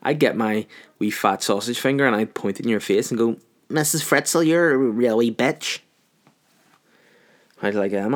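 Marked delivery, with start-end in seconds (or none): 3.7-5.8 drawn-out scolding, slightly raised pitch; mock telling-off. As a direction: deliver a slow mock accusation to her face. Imagined telling-off, finger in face.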